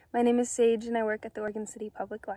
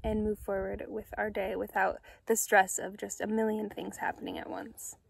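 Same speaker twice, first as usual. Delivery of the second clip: normal talking voice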